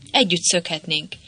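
ordinary delivery, nothing marked

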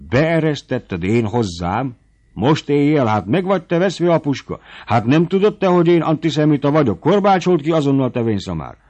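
incredulous furious rant; angry scolding. A narrator's reading. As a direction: scold him like a fool who let trouble in. Squire scolds servant angrily.

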